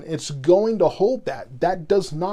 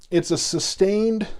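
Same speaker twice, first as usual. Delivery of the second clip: ordinary delivery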